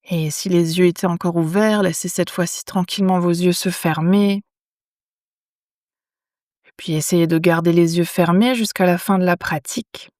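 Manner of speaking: calm yoga nidra guidance with long pauses; unhurried, measured phrases, leaving silence after each instruction; guided meditation. Acted. Teacher guiding lying listeners into yoga nidra, calm meditation voice.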